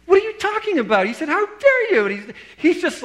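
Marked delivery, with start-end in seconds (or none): 0.1-1.1 baffled protest. Retold or imitated. Retold baffled reply to angry accuser. 1.3-2.1 retold outrage, loud and very high; livid. Visitor accusing the pastor at his door, acted livid. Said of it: "he was livid".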